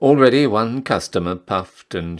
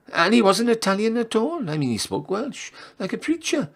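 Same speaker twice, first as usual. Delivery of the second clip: chatty barmaid; 11 semitones higher than usual. Acted. Barmaid gossip about monkey man.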